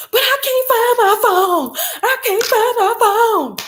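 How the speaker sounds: high mock whine of a teen; whiny daughter. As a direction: mimic the daughter pleading. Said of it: "daughter's reply in story".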